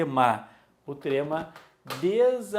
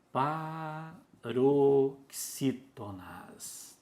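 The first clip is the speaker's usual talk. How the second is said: PAROXÍTONAS very slowly, syllable by syllable; slow dictation; much slower than usual. Shown as a demonstration. Teacher writing the term for the class, slow dictation. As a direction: draw out each syllable of "paroxítonas".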